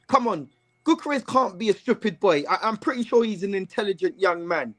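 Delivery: ordinary talk, nothing marked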